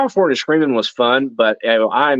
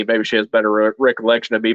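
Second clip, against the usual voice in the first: ordinary delivery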